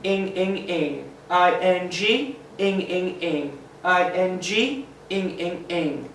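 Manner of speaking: "ing, ing, ing" chanted in threes; repeated sound drill. Shown as a demonstration. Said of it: "I'm just gonna remind you".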